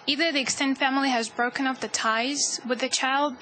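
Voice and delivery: usual speaking voice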